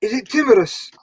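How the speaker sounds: a hammed-up Scottish burr on timorous; teasing a mate in a bad Scottish accent; mock Scottish accent. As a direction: roll out timorous like a mock Scotsman. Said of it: "For such an awful accent".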